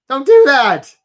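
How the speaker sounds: loud, high-pitched warning; sudden loud outburst; emphatic shout. Real, not acted. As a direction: shout it as a firm order.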